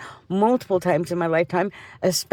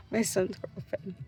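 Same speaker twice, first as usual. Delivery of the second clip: much softer, slow, under the breath; muttered repeat; 11 dB quieter, slower than usual. Real, not acted. Mother repeats the topic to herself, much softer. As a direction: say it again to yourself, dropping away.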